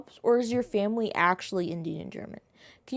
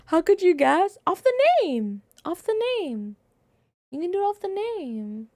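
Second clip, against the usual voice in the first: high, loud disbelief; can't believe the guess; incredulous high exclaim; surprised; 7 semitones higher, 6 dB louder than usual. Real, not acted.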